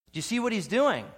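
ordinary delivery

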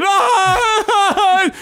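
laughing imitation of a sobbing girl; mock crying wail. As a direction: wail like someone bawling, laughing through it. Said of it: "is crying".

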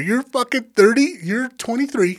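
high, exasperated self-rebuke; self-scolding. Retold or imitated. Injured lifter berates himself for overdoing it, exasperated. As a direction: act out berating yourself, rising to the last question.

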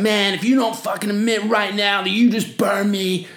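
a touch of playful rage at the guy who burned him; mock angry surfer. Burned big-wave surfer confronting the culprit, mock furious. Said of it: "Bradshaw's obviously, like, flaming".

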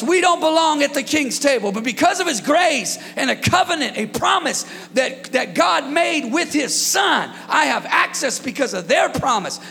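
building gospel preaching; full-force preaching. Acted. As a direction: preach it out to the congregation.

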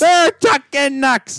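high, drawn-out battle cry; mock yell. Host yelling the tag team catchphrase, high mock battle cry. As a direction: stretch it into a wrestling catchphrase yell. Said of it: "you're gonna yell, "Chucking Nucks".

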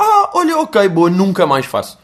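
a fake-happy brush-off; mock cheerful quitter. Imitating people who leave a class happy, hammed-up chirpy voice. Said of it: "saem bué da contentes".

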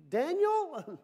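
high, loud questioning call; king calling anxiously. Acted. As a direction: call out high into the den, hammed-up. King calls into lions' den.

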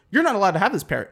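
acted indignant reprimand; mock scolding. Pilot scolds passenger in joke.

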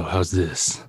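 deep, slow radio host voice; podcast voice. Pretend. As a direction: drop into a low broadcaster voice. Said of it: "This is my podcast voice".

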